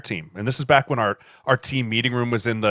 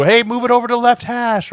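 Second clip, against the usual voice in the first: higher, louder sideline call; coach calling out; 12 semitones higher, 7 dB louder than usual. Retold or imitated. Coach setting up the kick, higher sideline call. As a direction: call the hash out across the field.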